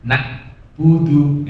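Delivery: "Na'budu" cut dead after "Na", like a glottal stop; stopped ain, wrong. Shown as a demonstration. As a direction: break off sharply at the ain in "Na'budu". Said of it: "Ini berhenti".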